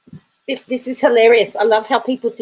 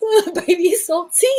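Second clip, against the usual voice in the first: high, laugh-choked voice; tearful laughter; 9 semitones higher than usual. Real, not acted. Repeating a nickname from the chat, helpless with laughter. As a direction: squeeze the words out through real laughter.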